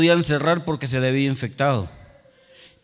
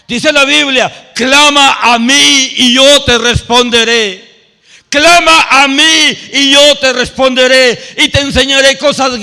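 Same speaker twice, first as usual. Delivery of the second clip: loud, slow, high preaching cry; preaching at full force; 9 semitones higher, 13 dB louder than usual. Acted. Preacher proclaiming a Bible promise to the congregation, loud and slow. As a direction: proclaim the promise to the whole church.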